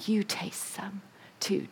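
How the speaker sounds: hushed whispered persuasion. Acted. Eve whispering to her husband about the fruit. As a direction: whisper it like a secret.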